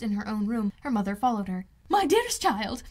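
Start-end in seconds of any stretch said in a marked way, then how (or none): 1.9-2.8 much higher and louder, breathless with delight; gushing excitement. A narrator's reading. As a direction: gush like an overjoyed mother who can't stop. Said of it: "she cried".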